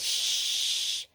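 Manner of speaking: drawn-out "shh"; long shushing hiss. Acted. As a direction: hush them so the sleeper stays asleep. Hushing so sleeper stays asleep.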